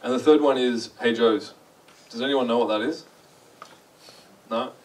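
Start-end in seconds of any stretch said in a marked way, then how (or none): none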